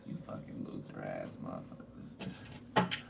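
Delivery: whispered, low and slow curse; under-breath curse. Real, not acted. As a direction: hiss it almost to yourself. Muttered insult at thieves.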